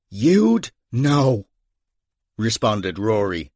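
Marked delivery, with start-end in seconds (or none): 0.1-1.5 out of breath. Acted. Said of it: "drawing in deep breaths".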